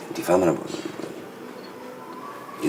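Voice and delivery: soft, breathy aside checking she follows; hushed check-in; whispered aside. Acted. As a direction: drop to a whisper mid-sentence. Whispered aside mid-lecture.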